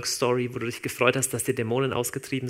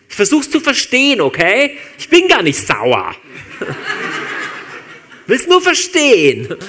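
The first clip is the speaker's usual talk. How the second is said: shouted fake calm, much higher and louder, laughing; mock furious; 6 semitones higher, 10 dB louder than usual. To Petrus, a hammed-up furious Jesus. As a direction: yell that you're not angry at all.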